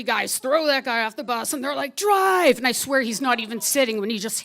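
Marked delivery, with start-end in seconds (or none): 2.0-2.6 high, loud urgent yell; shouted order. Retold or imitated. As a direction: yell the command at the driver. Gunmen ordering the driver.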